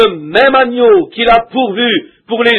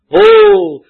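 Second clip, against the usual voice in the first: un « Oh » lancé très haut et fort; éclate d'un cri de ferveur; exclamation fervente; 11 semitones higher, 4 dB louder than usual. Real, not acted. Élan de prédicateur. (prédicateur qui s'enflamme devant l'assemblée, exclamation aiguë)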